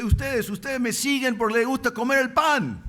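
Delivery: high, loud preaching cry; loud rebuke. Acted. Preacher quoting the Lord's rebuke to bread-seekers, impassioned shout. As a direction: raise the voice and hold it high to the end.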